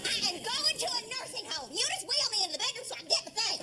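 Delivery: put-on high, indignant old woman; huffy old mother. Mother to her children, high indignant threat. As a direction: play the wounded martyr.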